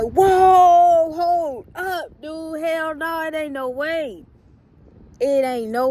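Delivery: outraged that someone took her car; shouted whoa, then high upset protest; mad disbelief. Retold or imitated. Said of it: "I'm just mad".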